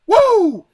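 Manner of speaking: loud high whoop. Real, not acted. Vlogger whooping after a slogan. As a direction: let out a big cheer.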